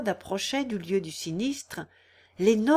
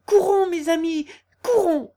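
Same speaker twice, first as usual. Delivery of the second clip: high, loud rallying call; rally the parishioners to run; exclaimed, pitched well up; urgent cry; 9 semitones higher, 7 dB louder than usual. A narrator's reading. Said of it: "s'écria le curé en s'élançant".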